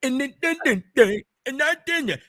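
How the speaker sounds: halting stuck-word stammer imitation; a mocking stammer repeating "then, then, then". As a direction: mimic someone who can't get past "then". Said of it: "talk like this".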